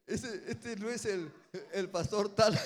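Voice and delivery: loud, high disbelieving exclamation; surprised recognition. Retold or imitated. Pastor spotting a colleague in a straitjacket, loud and surprised. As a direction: blurt it out in shock.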